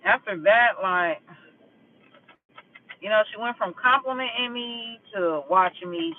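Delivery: ordinary talk, nothing marked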